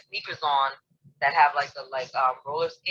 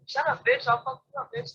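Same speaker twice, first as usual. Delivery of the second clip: higher imitated little girls' insults, mild mock yell; 10 semitones higher than usual. Girls passing by scream insults, mild mock yell. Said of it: "screaming, like".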